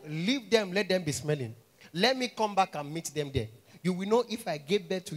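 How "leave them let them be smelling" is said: ordinary delivery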